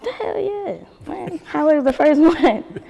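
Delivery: excited. Real, not acted. Eager yes to being supported.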